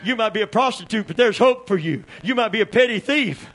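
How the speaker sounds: high, loud shouted preaching; climbing sermon shout; preaching at full force. Acted. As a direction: whoop it up like a revival preacher. Preaching builds again.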